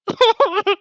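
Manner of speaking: a sharp, loud, high real laugh. Real, not acted. Reader laughs at her own line, high burst.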